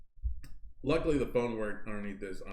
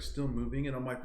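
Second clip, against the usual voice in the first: normal talking voice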